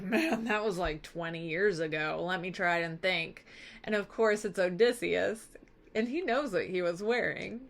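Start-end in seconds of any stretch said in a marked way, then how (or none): 0.0-3.5 low, soft musing in a retold voice; lightly acted pondering, pitched down; feigned recollection. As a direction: play at searching your memory, low and quiet. Beggar pretends to struggle remembering.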